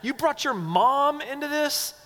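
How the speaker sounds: high, drawn-out incredulous outrage; mock indignation. Disciples mad that mom was brought in, mock-incredulous. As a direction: act appalled, stretching it out high. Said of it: "they're mad".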